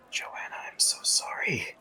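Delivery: hushed, whispery apology; muttered under breath. Acted. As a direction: mutter it softly to yourself. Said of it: "mutters under his breath".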